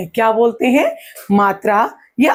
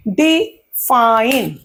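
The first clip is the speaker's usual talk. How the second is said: "Define" split slowly, short डि, long fine; stress on second syllable; much slower than usual. Shown as a demonstration. Teacher showing stressed and unstressed syllables, define with weight on fine. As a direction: pause after डि, then stretch fine. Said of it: "डी पर मुझे कम जोर".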